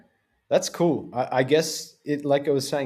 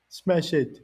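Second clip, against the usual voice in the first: put-on Jamaican accent; 5 semitones higher than usual. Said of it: "in his Jamaican accent".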